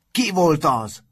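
high, loud, furious demand; wild angry shout. A narrator's reading. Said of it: "kiáltott vadul".

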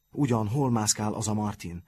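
plain reading voice

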